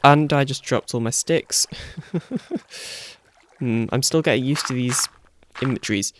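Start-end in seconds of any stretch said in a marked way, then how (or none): 1.7-3.1 soft breathy laugh. Real, not acted. Laughs after dropping sticks.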